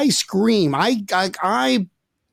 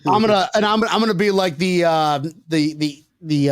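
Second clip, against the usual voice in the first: usual speaking voice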